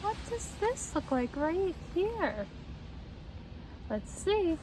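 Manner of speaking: usual speaking voice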